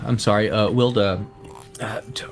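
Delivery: ordinary delivery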